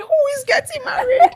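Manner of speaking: high, loud shriek of disbelief; hammed-up, piercing mock shock; shocked mother. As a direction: shriek the question as an outraged mother. Mother reacts in shock to news.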